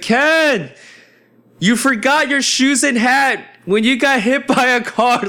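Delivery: high-pitched yell down the street; calling out. Retold or imitated. Pledge bro running after him with shoes, high frantic yelling. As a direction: shout after a friend, imitating a frantic buddy.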